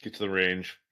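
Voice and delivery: ordinary talk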